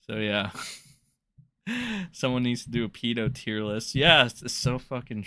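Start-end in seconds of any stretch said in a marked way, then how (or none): none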